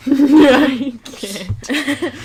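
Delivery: laughing through the words. Real, not acted. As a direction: breaks into a laugh while answering. Joke about death penalty.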